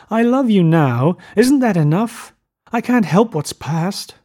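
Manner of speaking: high, slow, distraught pleading; pleading cry. A narrator's reading. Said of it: "she cried to Gatsby".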